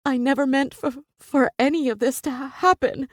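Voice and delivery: high, broken, tearful rush; crying. Acted. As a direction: voice breaking, fighting back tears. Tearful apology to Jasper.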